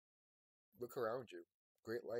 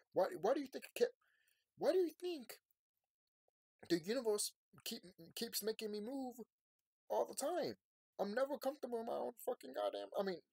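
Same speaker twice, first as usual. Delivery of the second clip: exasperated; 11 semitones higher than usual. Real, not acted. Exasperated rant, raised pitch.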